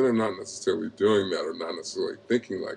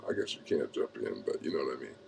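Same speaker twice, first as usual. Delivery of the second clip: much softer, lower aside; quiet aside; 7 semitones lower, 11 dB quieter, faster than usual. Real, not acted. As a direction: drop to a soft, low aside. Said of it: "I'm just freestyling".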